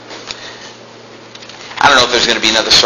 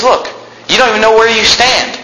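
pointed rebuke; 11 semitones higher than usual. Retold or imitated. Quoted rebuke.

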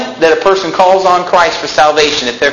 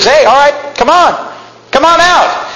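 high, eager call to come on; excited invitation; 10 semitones higher than usual. Retold or imitated. Jesus calling Peter out of the boat, eagerly. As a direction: beckon him out with enthusiasm. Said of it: "He says".

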